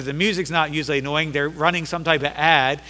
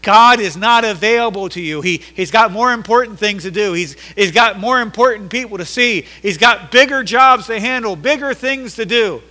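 loud, raised voice of scolding onlookers; dismissive crowd; 4 semitones higher, 7 dB louder than usual. Acted. Crowd telling blind Bartimaeus to shut up, dismissive. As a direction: shut him down forcefully, as the crowd. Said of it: "Told him to shut up".

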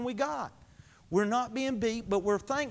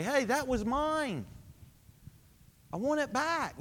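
mock pity-party whine; 6 semitones higher than usual. Preacher mimicking a believer's pity party, higher whine. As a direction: whine it like a sulking kid wanting a toy back. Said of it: "I wanna have a pity party".

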